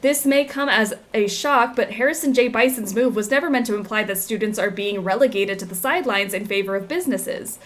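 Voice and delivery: newspaper article read aloud; news read. Acted. Reads in-game news article.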